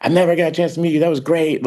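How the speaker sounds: Al Pacino. Retold or imitated. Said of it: "he was like".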